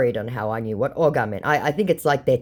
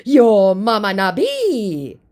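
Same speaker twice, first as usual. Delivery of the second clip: slow, raised, loud impression; mock angry scammer; 7 semitones higher, 6 dB louder, much slower than usual. Scammer insulting the baiter's mother, mock angry. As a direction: stretch the insult in a put-on voice.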